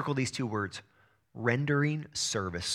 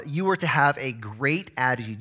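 ordinary talk

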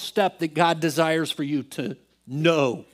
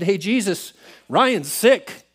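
higher, louder mock-demanding prayer; demanding attitude; 4 semitones higher, 5 dB louder, slower than usual. Telling Jesus that Ryan's sick, mock demanding. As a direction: call Jesus to attention, exaggerated. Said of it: "I wouldn't say it this way, but our attitude".

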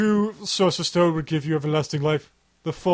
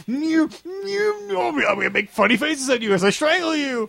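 hammy villain; 10 semitones higher than usual. Acted. Acting a villain strangling.

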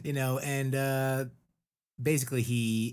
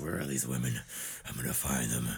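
sinister abuser; 9 semitones lower, faster than usual. Retold or imitated. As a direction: lower mock-sinister voice hunting for them. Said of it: "He's like".

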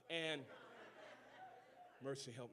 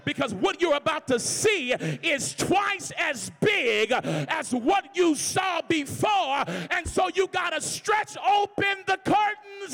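slow, loud, high shouted preaching; preacher yelling to the crowd; 9 semitones higher, 12 dB louder, slower than usual. Real, not acted. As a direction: stretch every word and yell it. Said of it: "sick of me yelling".

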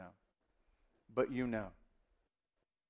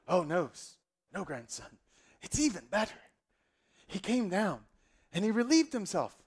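teasing granddad with swooping pitch; storytelling grandfather; 5 semitones higher than usual. Acted. Grandfather correcting his eager grandson, hammed-up storyteller voice. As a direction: relish the reveal as an old storyteller. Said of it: "tell us the story".

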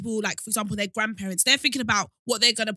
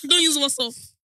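auntie voice; 3 semitones higher, 3 dB louder than usual. Pretend. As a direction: tell him off like a pushy auntie. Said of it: "Say it".

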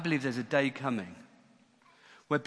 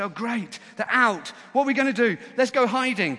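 loud, high burst of excitement; eager relief, voice leaping up; gleeful rush of freed prisoners; excited escapees; 8 semitones higher, 7 dB louder than usual. Acted. Disciples thrilled at jail escape.

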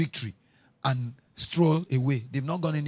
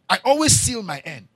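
shouted; 3 semitones higher, 6 dB louder than usual. Acted. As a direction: stress on always, shouted out. Repeated line, shouted.

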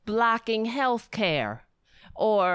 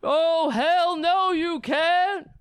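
hammed-up tearful protest, louder and higher; mock crying Boehner; 6 semitones higher, 4 dB louder, much slower than usual. Mocking Boehner's crying over Obamacare, hammed-up wail. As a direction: whine it like a weepy politician. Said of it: "John Boehner up there crying".

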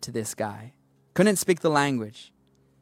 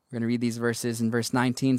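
usual speaking voice

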